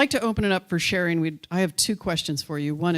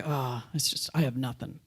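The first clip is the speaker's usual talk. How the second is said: lower, softer, mildly deflated quote; deflated participant; 5 semitones lower, 8 dB quieter than usual. Acted. Participant telling the group they have no gift, mildly deflated. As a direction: imitate someone mildly discouraged, dropping low and quiet. Voicing a discouraged next participant.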